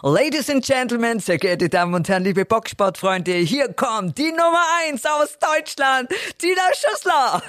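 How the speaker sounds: boxing ring announcer. Shown as a demonstration. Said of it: "wie würdest du denn einen Kampf von Tina Schüssler ankündigen als Ringsprecherin".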